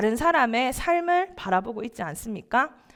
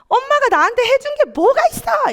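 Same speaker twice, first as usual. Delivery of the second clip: high, loud retort at mom; defiant teen yell; 12 semitones higher, 7 dB louder than usual. Retold or imitated. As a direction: snap back like an angry teenager. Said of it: "제가 이렇게 말했습니다". (엄마에게 대드는 고등학생의 외침)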